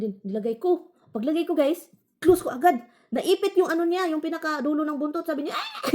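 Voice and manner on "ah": very high sudden yelp; cat yelp. Retold or imitated. Voicing the cat when its tail gets caught, yelping.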